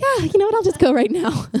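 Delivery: high, bright eager decision; eager sigh. Retold or imitated. Teen imitating herself deciding to nap now. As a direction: sigh happily and decide to sleep. Teen voicing sleep fantasy.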